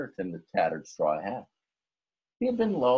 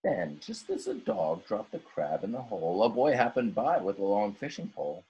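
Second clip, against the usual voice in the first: plain reading voice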